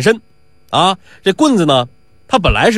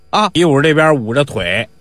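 ordinary delivery, nothing marked

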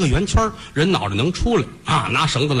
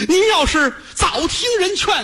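bitter outburst. Acted. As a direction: throw the blame back at her. Son berates his mother.

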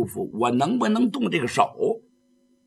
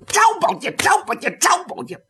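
fight calls; 13 semitones higher than usual. Acted. Sword strikes called out.

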